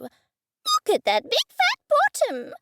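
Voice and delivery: very high, slow squawking bird voice; parrot squawk. Acted. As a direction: screech it like a cheeky cockatoo. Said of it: "he squawked".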